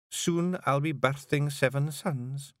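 slow, gentle reveal; tender news. Acted. Jeanie tells Rob of their sons, slowly and tenderly.

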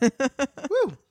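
high woo. Real, not acted. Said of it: "Getting over a cold".

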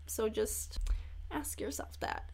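whispered, very soft; confiding whisper to the listener; hushed aside. Real, not acted. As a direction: drop into a whisper for the advice. Mock confidential advice, whispered.

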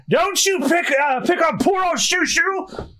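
high, drawn-out indignant elder; high-pitched geezer protest; cranky old-timer. Acted. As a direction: scold them in defence of a beloved dog. Old mayor defends the dog.

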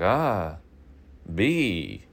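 a pleased teacher handing out a B; hammed-up teacher verdict; teacher grading. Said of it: "he was like".

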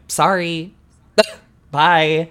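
mock dismissal. Telling Kimmy's challengers they're out, mock dismissal. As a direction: toss out a sing-off to a doomed rival. Mock brush-off to any rival.

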